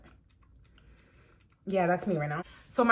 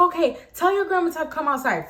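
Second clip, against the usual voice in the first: bossy lady; 7 semitones higher than usual. Retold or imitated. Neighbor lady ordering Jose, high bossy command. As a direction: command him, entitled.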